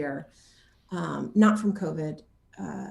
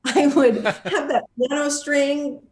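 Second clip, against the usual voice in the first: higher, louder and slow, mock-passionate; mock fervent vow; 7 semitones higher, 5 dB louder than usual. Real, not acted. Telling the interviewer how far she'd go for a horror role, hammed-up fervour. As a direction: swear it like a zealot, tongue in cheek.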